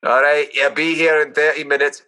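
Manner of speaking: broad Scottish brogue, gruff and teasing; thick Scottish accent on the phone. Acted. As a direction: play a Scottish customer ordering pizza. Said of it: "best Scottish accents I've heard".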